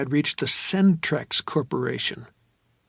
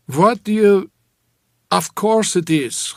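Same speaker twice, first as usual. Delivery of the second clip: loud, raised actor's bluster; jovial, booming actor; 6 semitones higher, 7 dB louder, slower than usual. A narrator's reading. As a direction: answer in a big, booming voice. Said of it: "That jovial, booming actor's voice".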